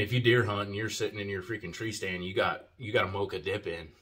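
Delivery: ordinary delivery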